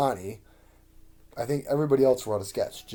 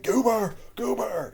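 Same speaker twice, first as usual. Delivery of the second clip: high-pitched cartoonish interjection; mock censor; 12 semitones higher, slower than usual. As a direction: blurt it out in a silly high voice, imitating a sketch. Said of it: "playing the person censoring people from saying words".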